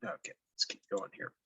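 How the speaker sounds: quick, soft whisper to himself; a hushed note to self, moving on; whispered aside. Real, not acted. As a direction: murmur it under your breath while working. Muttering to self mid-task.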